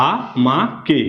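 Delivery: "আ মা কে" with a gap after each syllable; teacher's spaced reading of "আ মা কে". Shown as a demonstration. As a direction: sound out "আ", "মা", "কে" separately. Teacher sounds out the word.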